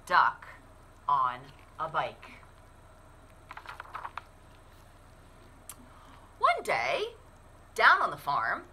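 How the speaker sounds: put-on lively storybook narration for kids; animated picture-book read-aloud; reading to children. A narrator's reading. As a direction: read it like story time at the library.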